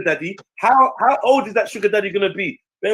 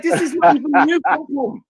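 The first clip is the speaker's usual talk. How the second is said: mocking dismissal; 8 semitones higher than usual. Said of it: "I'm like".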